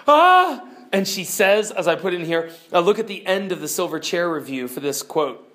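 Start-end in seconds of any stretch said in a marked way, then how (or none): 0.1-0.6 put-on high, loud girl's shriek of fright; startled scream. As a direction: shriek in sudden fear. Jill startled by lion.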